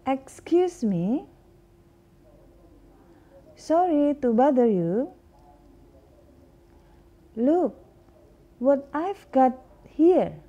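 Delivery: English teacher modeling phrases for the class; model pronunciation. Shown as a demonstration. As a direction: say each phrase clearly for students to copy. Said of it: "repeat after me".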